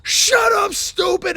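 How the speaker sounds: high mock shout; hammed-up high holler; imitated drunken yell. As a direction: blurt it like a wasted bandmate. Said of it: "he'd yell".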